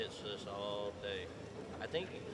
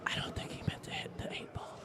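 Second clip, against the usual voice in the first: whispered commentary; whispering; 5 semitones higher than usual. Real, not acted. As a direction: hush it like golf.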